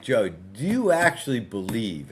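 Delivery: "believe" drawn out slowly with emphasis; stress on believe. Retold or imitated. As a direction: lean slowly and firmly on the word "believe". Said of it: "I emphasize the word believe".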